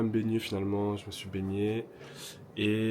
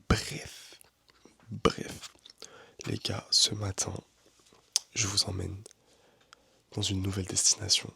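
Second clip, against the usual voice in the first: whispering; slower than usual. Real, not acted. Whispering early, others asleep.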